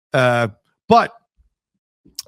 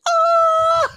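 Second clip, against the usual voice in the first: shrieked high C, much higher and louder; woman's high scream; 3 dB louder than usual. Retold or imitated. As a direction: hit an operatic high C like a woman. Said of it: "they dubbed it like a woman's voice".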